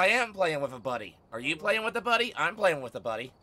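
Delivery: ordinary talk, nothing marked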